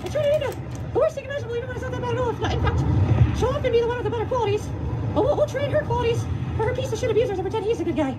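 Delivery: high, sarcastic mimicking voice; mock the haters in a high voice; mocking sing-song. Mocking the critics' view of her.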